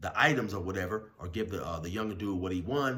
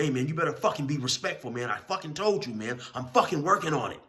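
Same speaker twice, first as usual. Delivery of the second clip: higher and louder warning; retold angry warning, higher and louder; 6 semitones higher, 5 dB louder than usual. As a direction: warn him while shoving him off. Big dude shoves, warns him.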